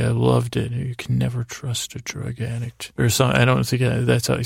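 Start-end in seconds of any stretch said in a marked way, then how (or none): 0.7-2.9 softly imitating quiet authority; quiet and powerful. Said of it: "he speaks so quietly and powerfully".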